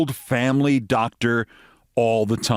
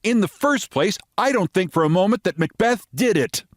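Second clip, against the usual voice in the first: American lady voice; 10 semitones higher than usual. Acted.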